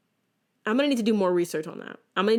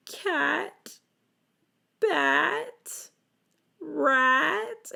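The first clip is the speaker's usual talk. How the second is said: higher, each word drawn out; child sounding out; 9 semitones higher, much slower than usual. Retold or imitated. Young girl drilling phonics, higher sounded-out words. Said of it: "sitting there in tears".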